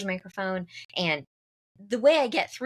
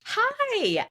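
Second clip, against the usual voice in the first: much higher, eager hi waiting for praise; eager hopeful hi. Retold or imitated. Speaker to translator, expecting praise, a high eager hi. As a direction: chirp it out like you expect a compliment. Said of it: "like, waiting for the compliment".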